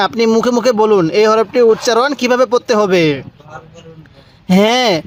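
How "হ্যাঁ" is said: ordinary talk, nothing marked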